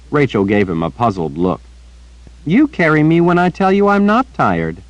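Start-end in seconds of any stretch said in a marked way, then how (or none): 2.5-4.8 put-on high, earnest little girl chattering; bright-eyed child repeating gossip to the old wizard. A narrator's reading. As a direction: pitch it up like a young girl telling news. Child Rachel voiced.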